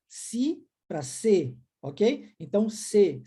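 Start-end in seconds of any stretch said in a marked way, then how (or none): 0.1-1.6 very slow glide from "Si" to "se"; slow i to e contrast. Shown as a demonstration. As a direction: slowly show the i turning into e. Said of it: "Olha a diferença".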